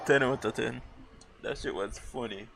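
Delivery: ordinary talk, nothing marked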